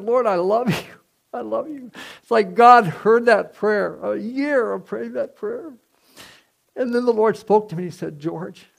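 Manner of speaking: preaching at full force. Acted. Pastor telling the congregation of answered prayer, fervent preaching. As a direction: lift the room as the story peaks.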